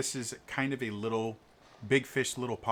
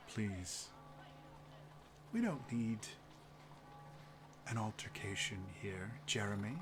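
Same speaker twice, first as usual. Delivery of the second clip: gently calm the hothead down; calm restraining noble; 12 dB quieter than usual. Acted. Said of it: "and goes".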